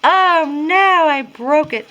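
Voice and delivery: loud, high wail of oh no; dismayed cry. Real, not acted. Crafter breaks the tire swing she is making, dismayed cry.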